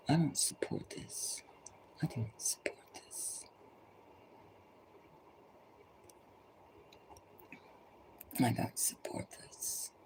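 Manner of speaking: soft, firm whisper of refusal. Real, not acted. Rejecting the system to listeners, in a whisper. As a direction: breathe it out, refusing quietly. Quiet refusal repeated thrice.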